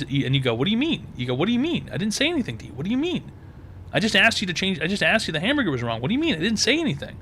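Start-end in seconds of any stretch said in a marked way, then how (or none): 0.5-1.1 high-pitched mock bafflement; feigned innocence. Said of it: "Gaslight her". 1.3-7.2 high-pitched mock bafflement; feigned innocence. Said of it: "Gaslight her".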